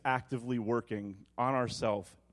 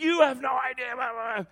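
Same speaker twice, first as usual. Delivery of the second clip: hammed-up yell at his wife; retold yell; 11 semitones higher than usual. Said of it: "I'm, like, yelling at her mother".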